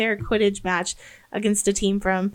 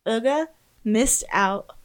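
ordinary talk

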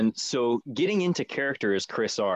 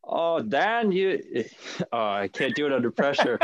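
laughing; 5 semitones higher than usual. Real, not acted. Laughing while refusing impression.

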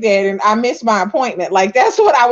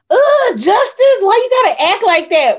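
acted exasperation; 8 semitones higher, 3 dB louder than usual. Acts out a movie line.

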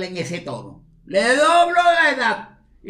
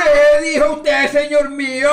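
loud, higher indignant demand; outraged shout; 4 semitones higher, 7 dB louder than usual. Acted. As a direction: shout back in disbelief. Father furious at the insult.

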